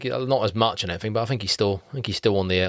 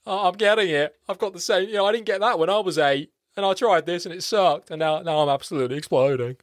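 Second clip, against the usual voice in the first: a touch higher self-pitying moan; lightly voiced bitter postman; whining loser; 4 semitones higher than usual. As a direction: moan about lost chances. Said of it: "Sinbad going like".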